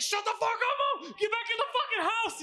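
high mock shout; retold furious yell. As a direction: yell it back like an embarrassed son. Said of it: "he's yelling".

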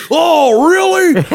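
high, loud, delighted monster; Sweetums. Acted. Big monster picked as helper, delighted high voice. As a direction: gush like a thrilled big puppet monster. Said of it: "And he goes".

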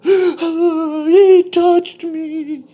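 high wailing mock sob, stretched out very slowly; fake bawling. Coworker fake-sobbing his story to the lawyers, mock bawling. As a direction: ham up a crocodile-tears wail. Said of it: "he just starts bawling".

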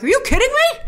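high, incredulous rising question; exasperated disbelief. Retold or imitated. Viewer reacting to bad decisions, high and incredulous. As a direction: call out the characters' stupidity.

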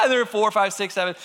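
plain reading voice

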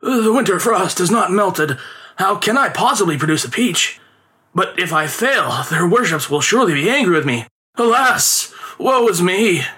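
hammed-up lament, alas and woe; complaining performer. A narrator's reading. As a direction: whine about the impossible task. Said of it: "complaining loudly".

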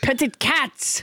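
mock French accent; French Trump. French Trump insists on petted cats to co-host, French accent. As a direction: repeat it in a comic French accent. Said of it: "a French translation for petty fours".

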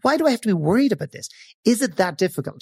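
light, breezy and high; carefree inner voice, high and quick; playful. Shown as a demonstration. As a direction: say it like it's fun. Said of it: "feel it and say".

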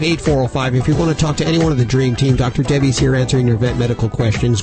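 normal talking voice

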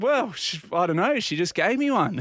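high-pitched helpless protest; retold naive bewilderment; flustered defence. Patient flustered, defending his claim.

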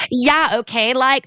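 high mock Valley Girl accent; hammed-up American Valley Girl imitation. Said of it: "they would do, like, Valley Girl".

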